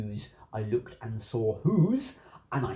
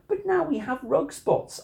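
high-pitched exasperated yell; storybook yell, pitched way up; 13 semitones higher, 3 dB louder than usual. A narrator's reading. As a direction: cry out in dismay at the new mess. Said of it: "I yelled".

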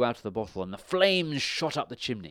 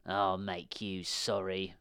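slow, quieter witch voice; menacing witch, drawn out and low in volume; witch's threat; 3 semitones lower, 5 dB quieter, slower than usual. A narrator's reading. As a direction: threaten slowly as an old witch. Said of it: "the witch said".

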